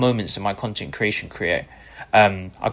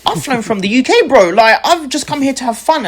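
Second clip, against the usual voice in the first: mock disbelief; 12 semitones higher, 8 dB louder than usual. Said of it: "And I was like".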